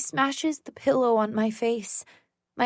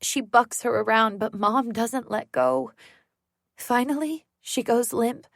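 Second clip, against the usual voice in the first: plain reading voice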